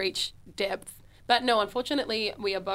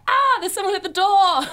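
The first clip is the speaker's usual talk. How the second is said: mock-terrified scream, loud and very high; 10 semitones higher, 8 dB louder than usual. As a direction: shriek it in hammed-up panic. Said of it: "and terrified".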